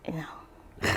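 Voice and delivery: a soft whispered you know; whispered aside. Real, not acted. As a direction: drop to a whisper, trailing off. Trailing aside after answer.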